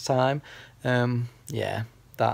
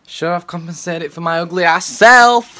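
loud; 6 semitones higher, 15 dB louder than usual. Real, not acted. Joking loudly about his looks.

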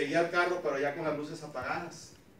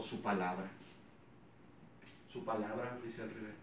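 a hint of a lower, softer fatherly voice; father's solemn answer; 7 semitones lower, 12 dB quieter than usual. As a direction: lightly voice the wise old father giving the answer. Father's answer in retold dialogue.

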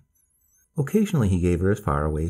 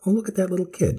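put-on high, eager old lady; grandma voice; 11 semitones higher, faster than usual. A narrator's reading. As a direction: point it out like a delighted grandma. Grandmother points out child.

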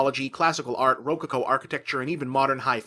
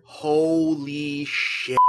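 very slow, stretched-out exclamation; drawn-out amazement; 3 dB louder, much slower than usual. Acted. Critic reacting once the film gets going, drawn-out amazement. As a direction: stretch each syllable in stunned emphasis. Awed reaction to film's violence.

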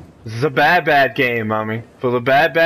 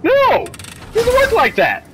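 outraged high yell at the game; indignant shout; slower than usual. Real, not acted. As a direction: yell the no way up high, then lay down the law slowly. Ranting at game monster.

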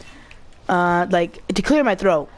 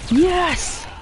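high, excited yes; triumphant; 10 semitones higher than usual. Real, not acted. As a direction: cheer the win. Triumph in game.

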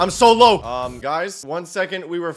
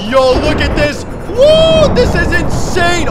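excited cheer; 13 semitones higher, 12 dB louder, slower than usual. Real, not acted. Destroying village, cheering.

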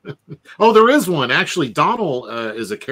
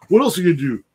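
mock friend; 5 semitones higher, 5 dB louder, much faster than usual.

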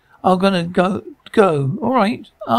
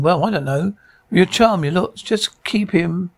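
ordinary talk, nothing marked

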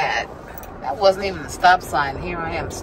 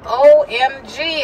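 high, drawn-out mock alarm; retold OMG moment; 7 semitones higher, 5 dB louder, slower than usual. Retelling the moment she remembered a forgotten errand, mock alarm. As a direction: stretch it out, louder and higher. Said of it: "And I was like".

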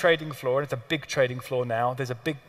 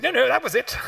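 mock-posh dismissal; 9 semitones higher, 3 dB louder than usual. Q flatly ending call.

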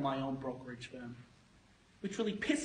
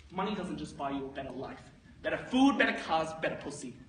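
ordinary delivery

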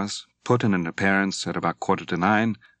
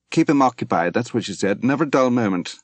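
higher, louder brittle mockery; bitter mock quote; 5 semitones higher, 6 dB louder than usual. A narrator's reading. Drunk Moira mocks Mary's advice to Dwight, high and brittle. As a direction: spit back Mary's words with a wild edge.

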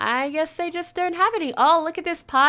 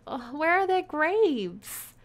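normal talking voice

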